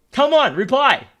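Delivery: usual speaking voice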